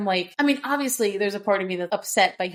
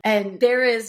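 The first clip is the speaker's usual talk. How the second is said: usual speaking voice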